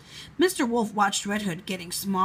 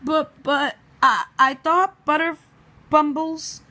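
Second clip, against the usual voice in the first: flustered stammer, pitched up; bumbling; 5 semitones higher, 6 dB louder than usual. Acted. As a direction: stumble over the words in panic. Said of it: "bumbled the entomologist".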